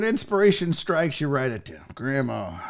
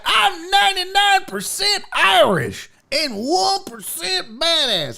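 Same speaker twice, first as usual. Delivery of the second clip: high, slow, shouted mock boast; boastful buddy; 11 semitones higher, 3 dB louder, slower than usual. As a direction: proclaim it proudly as the cocky friend. Buddy's proud boast, acted.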